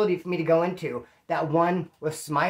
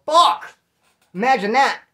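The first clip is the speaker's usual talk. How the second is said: shocked outburst; 5 semitones higher, 7 dB louder than usual. Retold or imitated. Reacting to the premonition.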